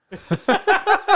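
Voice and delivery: loud squealing laugh; high burst of laughter. Real, not acted. Laughing at own joke.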